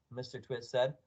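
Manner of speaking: ordinary delivery, nothing marked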